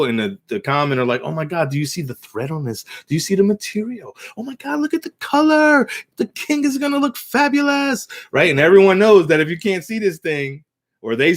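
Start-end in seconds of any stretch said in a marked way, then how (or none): 1.2-8.1 high, fawning, over-the-top gush; butter up the king shamelessly; gushing con men. Retold or imitated. Con men praising the invisible robe, high fawning gush.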